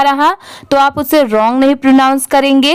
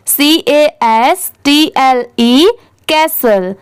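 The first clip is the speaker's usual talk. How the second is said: ordinary talk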